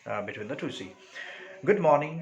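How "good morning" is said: loud, higher cried greeting. A narrator's reading. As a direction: call the greeting out brightly. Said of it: "she cried".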